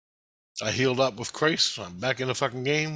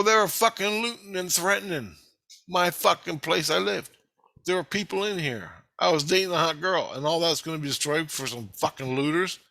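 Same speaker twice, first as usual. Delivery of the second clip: raised, higher indignant protest, retold; heated retort; 6 semitones higher than usual. Arguing back at Christ over the looters, retold indignant protest.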